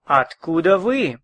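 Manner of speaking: usual speaking voice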